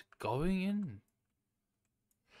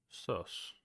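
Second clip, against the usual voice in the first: breathy whisper of the word; just breathe the word out; under-the-breath whisper; 9 semitones lower, 10 dB quieter than usual. Real, not acted. Repeats order softly to teammate.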